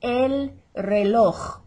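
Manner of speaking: slow "reloj" with a hacked, rasping final j; exaggerated throat J. Shown as a demonstration. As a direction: say "reloj" as if coughing up a fur ball. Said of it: "you're coughing up f-, a fur ball or phlegm".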